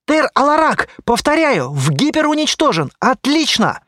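urgent high-pitched call; shouting report. A narrator's reading. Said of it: "закричал я".

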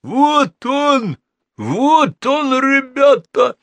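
high yelling thug voice; terrible yell. Acted. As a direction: bellow it out to the gang. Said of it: "раздался страшный вопль".

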